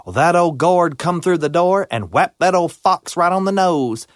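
ordinary delivery, nothing marked

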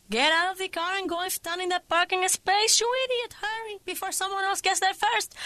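high, pushy scolding, peaks on idiot; bossy mother. Acted. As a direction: order her out impatiently. Mother ordering daughter to parking space.

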